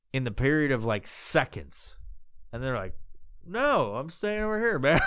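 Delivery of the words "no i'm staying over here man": thrown-off reply. Retold or imitated. Imitates the thrown-off man's reply.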